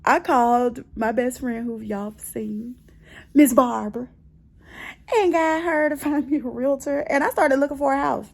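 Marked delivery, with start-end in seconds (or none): none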